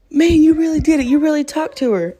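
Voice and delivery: high, loud mock amazement; hammed-up disbelief; imitated amazed guy. Imitating astonished guy at camp.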